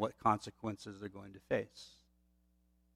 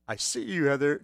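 playful seek call; 4 semitones higher, 4 dB louder than usual. Retold or imitated. Father teasing hiding toddler.

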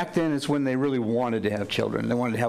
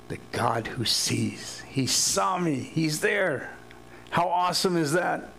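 awed praise; 4 semitones higher, slower than usual. Acted. Hagar exclaiming to God in wonder. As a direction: voice lifts high in awed wonder.

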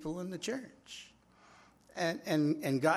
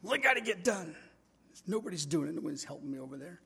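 complaining whine; 4 semitones higher, faster than usual. Retold or imitated. Pastor griping to God, higher, fast and grumbling. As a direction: rattle off the complaint in a huff. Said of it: "He says".

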